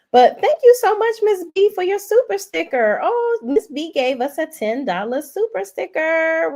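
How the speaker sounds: high-pitched, delighted gushing thanks; excited gratitude. Real, not acted. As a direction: gush loudly at a generous gift, pitch leaping up. Excitedly thanks viewer for super sticker.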